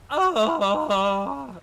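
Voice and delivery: imitated female death scream; loud high moaning "Oh!"; death cry. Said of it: "the Norn female one".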